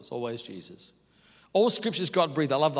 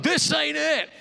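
high, loud declaration; Jesus clearing temple; 12 semitones higher, 7 dB louder, slower than usual. Retold or imitated. Jesus storming into the temple, declaring loudly. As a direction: call it out sharply over the crowd. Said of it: "he's like".

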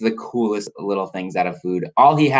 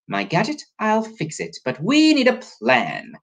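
higher-pitched scheming professor; storybook professor, pitched up; Professor Biscotti; 12 semitones higher than usual. Acted. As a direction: reassure in a high, put-on voice. Biscotti speaks in character.

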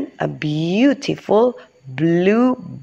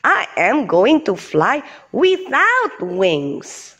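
put-on proud buffalo kid; 6 semitones higher, slower than usual. Bucky character voice.

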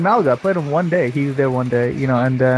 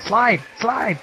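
imitated yell; 7 semitones higher than usual. Retelling how his teammate yelled out during the match, mock yell. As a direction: call it out high, imitating a teammate. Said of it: "he was just yelling out".

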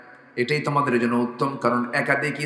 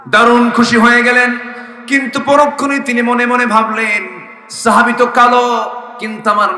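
full-voice preaching; 9 semitones higher, 10 dB louder than usual. Acted. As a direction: preach it out loudly on a raised, sustained pitch. Preaches in a loud chant.

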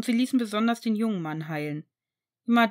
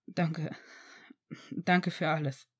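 breaking voice; 5 semitones lower, 6 dB quieter, slower than usual. Acted. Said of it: "mit brüchiger Stimme".

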